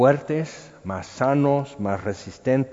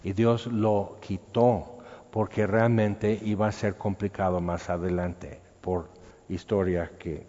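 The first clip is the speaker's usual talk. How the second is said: ordinary talk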